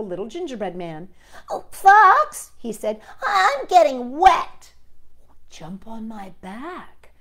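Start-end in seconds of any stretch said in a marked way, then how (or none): 1.5-2.5 high, loud alarmed call; worried gingerbread man. Acted. The gingerbread man calling to the fox, high and loud. 3.3-4.7 high, loud and slow whine; worried gingerbread man. Acted. The gingerbread man complaining to the fox, high and loud. 5.5-7.1 soft, slow coaxing fox; sly fox. Acted. The fox tempting the gingerbread man, soft and slow. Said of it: "cried the wily red fox".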